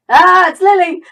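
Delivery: high, loud exclamation of recognition; startled cry. Real, not acted. Gamer recognising Lily the cat, startled cry. Cries out at the cat's reappearance.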